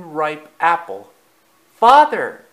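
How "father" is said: high, loud call to Father; excited cry. A narrator's reading. Bambi calls his father to the tree, excited cry. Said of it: "cried Bambi".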